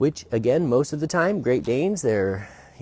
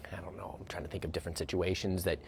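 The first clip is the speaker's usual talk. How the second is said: whisper; 4 semitones lower, 12 dB quieter, faster than usual. Real, not acted. Trailing off, whispered.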